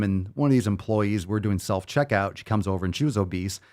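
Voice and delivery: normal talking voice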